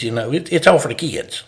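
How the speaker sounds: lightly voiced overzealous Alabama coach; Alabama coach accent. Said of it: "I can fall into that accent with him".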